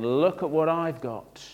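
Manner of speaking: slow, mildly mock showing off; a touch of self-satisfaction; boastful. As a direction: draw out the brag. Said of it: "That's us saying".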